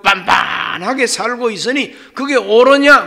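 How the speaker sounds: high, loud prophet's rebuke; prophetic rebuke. Retold or imitated. Prophet Haggai rebuking the people of Judah, loud and high. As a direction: thunder at the people over the ruined temple. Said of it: "애언합니다".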